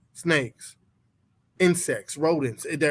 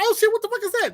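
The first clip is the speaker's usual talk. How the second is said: mock shriek, much higher; panicked wife; much faster than usual. Husband imitates his wife spotting a spider, high mock panic. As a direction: squeal it like a startled wife.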